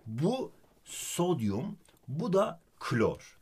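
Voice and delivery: "sodyum" and "klor" drawn out slowly, syllable by syllable; teacher says "sodyum" and "klor" slowly, syllable by syllable; spelled out slowly. Shown as a demonstration. Teacher spells element names slowly for student. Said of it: "Naci diye okumayacağız".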